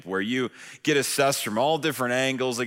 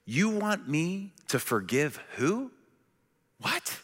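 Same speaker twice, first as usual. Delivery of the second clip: incredulous; 4 semitones higher, 5 dB quieter than usual. Retold or imitated. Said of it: "a little something like this".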